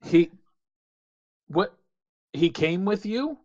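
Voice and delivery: high, loud, rising question; astonished query climbing at the end; incredulous. Acted. Captain hears surprising news.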